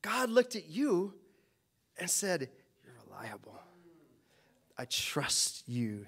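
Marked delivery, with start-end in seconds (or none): 2.8-6.1 whispered, slow and gentle reassurance; tender whisper. Retold or imitated. God quietly telling the believer they are trusted, tender whisper. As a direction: breathe it like a quiet promise.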